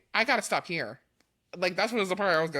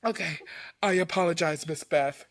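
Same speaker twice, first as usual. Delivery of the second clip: usual speaking voice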